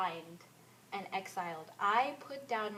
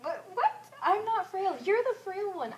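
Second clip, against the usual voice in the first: indignant; 10 semitones higher, 6 dB louder than usual. Acted. Indignant retort in the debate.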